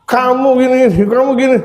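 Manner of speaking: high-pitched mock scolding; high nagging wife impression. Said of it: "lagi diomelin sama istrinya".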